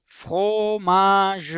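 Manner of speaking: FROMAGE very slow, loud and high; teacher stretching FROMAGE syllable by syllable; drawn-out word demo. Shown as a demonstration. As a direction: hold each syllable of FROMAGE, letting the j sing. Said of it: "la syllabe qui chante j".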